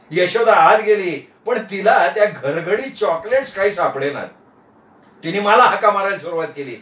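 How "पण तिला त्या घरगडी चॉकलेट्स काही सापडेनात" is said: ordinary delivery, nothing marked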